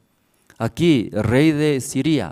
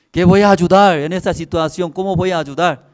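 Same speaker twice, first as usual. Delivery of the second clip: loud, higher-pitched acted protest; exasperated king's retort; 6 semitones higher, 7 dB louder than usual. King answering a starving woman's plea, loud acted exasperation. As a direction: throw the plea back loudly as the king.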